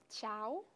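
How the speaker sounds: "cháo" read as a Vietnamese word, rising tone; hesitant beginner's guess at "cháo", one syllable with a rising end; naive learner's misreading. Pretend. Student plays her younger self guessing, naive misreading. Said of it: "pretend to be me about seven or ten years ago, when I had no idea about English".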